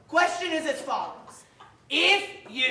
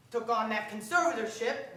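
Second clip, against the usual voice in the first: ordinary talk, nothing marked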